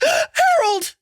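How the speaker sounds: very high piercing yell. Acted. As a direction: scream his name in shock. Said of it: "Missus Armstrong yelled".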